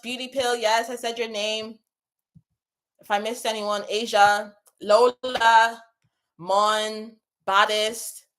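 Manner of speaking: ordinary talk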